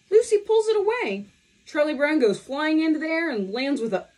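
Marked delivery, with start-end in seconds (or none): 0.1-1.3 much higher and louder narration; dramatic reveal. A narrator's reading. Narrator reveals Lucy's trick, high and loud. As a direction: land the twist with a jolt. Narrating the ball yanked away.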